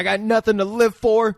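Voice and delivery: high, loud desperate cry; mock freaking out. Gunman on the porch despairs, mock freaking out. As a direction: cry out as an imitated man at the end. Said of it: "Mekhi Phifer is freaking out".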